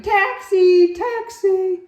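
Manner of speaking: high, loud street call; shouting for a taxi. Retold or imitated. Camel shouts for a taxi, high and loud. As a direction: call out for a cab. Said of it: "standing there shouting".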